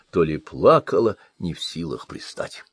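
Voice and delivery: usual speaking voice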